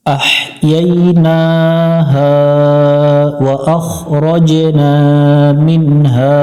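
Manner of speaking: slow, drawn-out melodic chant; Quran recitation. Shown as a demonstration. Teacher chanting the verse for students, slow tajweed recitation. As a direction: recite slowly, stretching each vowel.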